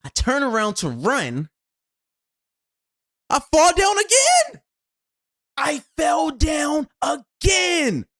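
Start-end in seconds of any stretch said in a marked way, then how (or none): 3.3-8.1 exasperated outburst. Retold or imitated. Runner telling of falling again, exasperated outburst. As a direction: shout the frustration of falling again. Exasperated retelling of third fall.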